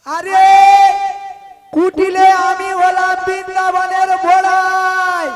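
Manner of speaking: slow, drawn-out shout; exasperated loud correction. Acted. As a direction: shout each word out for someone who misheard. Correcting someone who misheard the name. (চেঁচিয়ে, টেনে টেনে নিজের পরিচয় দেওয়া)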